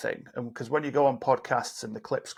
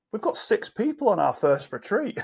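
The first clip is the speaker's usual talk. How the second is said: upbeat, higher-pitched retelling of good news; positive reframe; 8 semitones higher than usual. Retold or imitated. Client turning the retreat setback into good news, upbeat and high. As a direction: deliver the same news as a pleased announcement. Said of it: "said in a different way".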